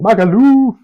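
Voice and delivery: high, loud holiday cheer; excited shout. Real, not acted. Co-host cheering a remembered holiday, excited shout. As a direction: belt out the place name.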